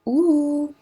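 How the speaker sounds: "ooh" high and rounded, lips pushed forward; a pure tight "ooh"; French ou sound. Shown as a demonstration. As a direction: model vowel "ooh".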